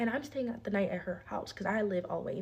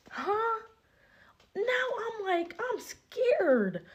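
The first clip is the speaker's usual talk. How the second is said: high, breathy, startled; mock scared disbelief; 13 semitones higher, 4 dB louder, much slower than usual. Reacting to the friend's crying, high and mock scared. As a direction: squeak it out in fake fright. Fear after friend's outburst.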